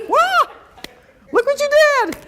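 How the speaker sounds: high, shouted mock scolding; mock angry scolding. Shown as a demonstration. Parent scolding a toddler for the toilet paper mess, mock yell. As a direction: yell at the child in pretend anger.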